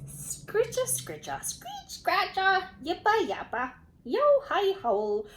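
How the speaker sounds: squeaky screeches, yips and a howl, high and loud; screeching, yipping and howling; noisy animal screeching. Acted. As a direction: play a whole squabbling crowd of creatures. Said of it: "The noisy animals screeched and howled".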